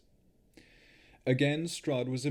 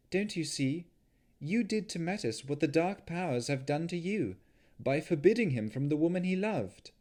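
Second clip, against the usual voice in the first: much higher, earnest plea; Navara pleading; 8 semitones higher than usual. Acted. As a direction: make him see the truth. Navara pleading her point.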